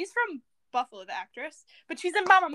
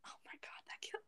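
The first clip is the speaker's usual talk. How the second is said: shocked whisper; 23 dB quieter than usual. Real, not acted. Shocked friend gasps.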